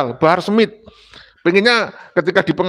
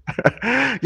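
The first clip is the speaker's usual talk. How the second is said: short, soft chuckle; a brief real laugh at his own anecdote; amused chuckle; 5 semitones higher, 6 dB quieter than usual. Real, not acted. Speaker recalling the so-called international seminar, amused chuckle.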